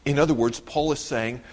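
normal talking voice

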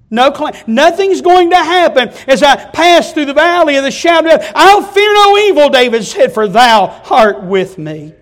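loud, high impassioned proclaiming; preaching at full force. Acted. Preacher to congregation, loud impassioned psalm. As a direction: cry out the psalm's promise.

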